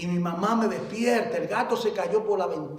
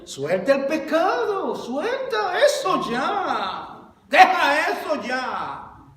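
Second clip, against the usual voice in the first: high, slow, pleading call with a laugh in it; urgent plea; 8 semitones higher, 7 dB louder, much slower than usual. Acted. Preacher to his flock, urging them to drop sin, slow high plea. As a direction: beg them to let go, stretching each word.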